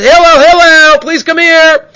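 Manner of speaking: high, loud call through the door. Acted. Man at the door calling Hillel out of the shower, loud and high.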